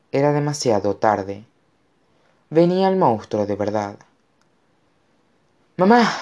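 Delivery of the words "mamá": loud, high cry for mum; grito. A narrator's reading. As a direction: cry out sharply to your mother. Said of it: "gritó Connor". (grito fuerte y agudo)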